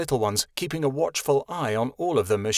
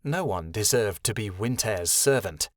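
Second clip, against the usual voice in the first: ordinary delivery, nothing marked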